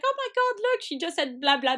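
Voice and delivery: put-on high, swooning gush over her toddler; gushing proud mum. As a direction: coo it like the baby just did magic. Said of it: "I'm like".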